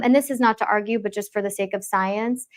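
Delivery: ordinary talk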